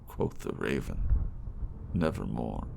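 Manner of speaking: theatrical recital with swelling passion and hushed ends; dramatic poem reading. Acted. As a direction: recite it like a stage performance. Reads The Raven aloud.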